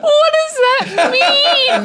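high, drawn-out cry through laughter; helpless, baffled wail of laughter; laughing disbelief. Real, not acted. Baffled by the absurd headline, laughing in disbelief.

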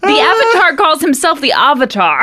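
first "Avatar" as AV-uh-tar, last "Avatar" as AH-vuh-tar; high, insistent contrast of AV and AH in "Avatar"; contrasting pronunciations. Shown as a demonstration. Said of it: "it's not Avatar or Avatar".

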